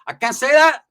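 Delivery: high, loud mock protest; indignant. Snapping back at being told not to say it, high mock indignation.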